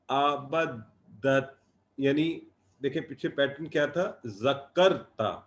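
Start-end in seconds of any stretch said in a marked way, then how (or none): none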